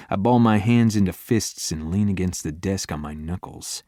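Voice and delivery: ordinary delivery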